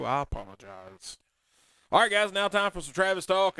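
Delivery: normal talking voice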